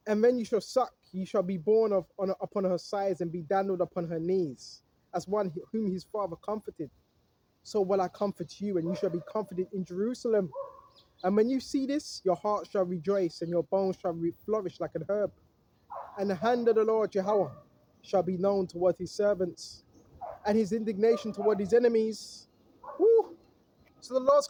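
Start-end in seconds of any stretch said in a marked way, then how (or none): 0.1-22.5 lower, measured Bible reading; scripture reading. Acted. Preacher reads Isaiah to his viewers, solemn and low. 23.0-23.4 high, joyful woo; excited whoop. Real, not acted. Preacher whoops for the Lord's return, excited. Preacher cheers after the reading.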